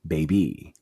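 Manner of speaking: low, drawn-out sass; condescending baby. Acted. Mocking the ranch as a toddler, low and sassy. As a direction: talk down to the ranch.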